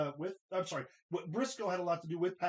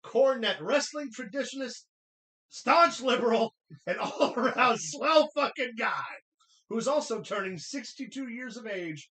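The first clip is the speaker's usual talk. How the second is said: booming ring announcer intro, building louder; 5 dB louder than usual. Pretend. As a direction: announce him like a big arena star.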